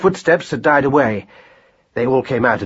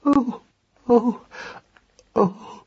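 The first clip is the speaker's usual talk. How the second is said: high, soft, fading moans; small whimpers of agony; quiet cries of pain; 10 semitones higher, 4 dB quieter, much slower than usual. A narrator's reading. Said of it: "cried Marcus Aurelius quietly".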